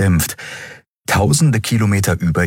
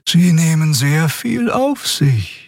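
slow, high-pitched put-on voice; high character voice; 10 semitones higher, slower than usual. A narrator's reading. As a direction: draw it out high and deliberately.

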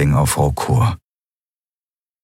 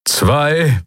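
higher-pitched count with an arching tone; commanding countdown; 7 semitones higher than usual. Acted. Admiral counting down to the final detonation, commanding and raised. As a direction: call out the number as an order.

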